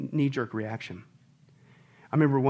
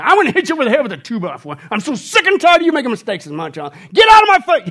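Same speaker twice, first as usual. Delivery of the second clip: mock angry God, shouting louder and higher to a peak; hammed-up furious father figure yelling; 9 semitones higher, 11 dB louder than usual. Pastor acting out a God who loses patience, shouting. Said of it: "He's not gonna think about it for a week and say".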